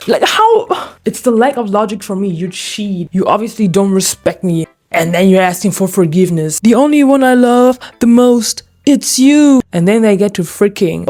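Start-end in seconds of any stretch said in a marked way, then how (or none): 0.1-0.7 sudden high squeak of disbelief; incredulous. Real, not acted. Mocking the cheater, high squeaky how. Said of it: "mock confused exasperation". 6.6-9.6 higher, louder mock declaration; mock romantic cheater. Reading the cheater's line, a hammed-up declaration.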